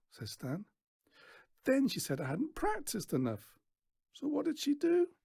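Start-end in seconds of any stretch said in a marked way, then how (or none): 1.7-5.1 high, sharp voice climbing; bitter girl. A narrator's reading. Daughter recounting her mother leaving, high bitter. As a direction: tell it with a grudge.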